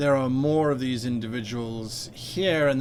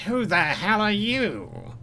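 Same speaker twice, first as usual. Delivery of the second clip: sharp, high, slow demand; startled Sanballat; 8 semitones higher, slower than usual. Acted. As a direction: snap at the intruder in surprise. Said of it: "Sanballat says".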